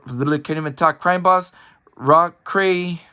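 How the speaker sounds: ordinary delivery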